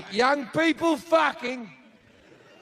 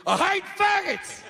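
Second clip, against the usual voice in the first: higher, hammed-up yell; edited soundbite; 10 semitones higher than usual. Shown as a demonstration. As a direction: blurt it as a clipped soundbite. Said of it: "edit that last routine down to".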